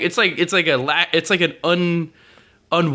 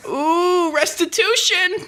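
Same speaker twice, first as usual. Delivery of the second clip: mock grovel; 12 semitones higher, much slower than usual. Hammed-up groveling apology.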